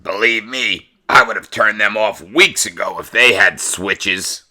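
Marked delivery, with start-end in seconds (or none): none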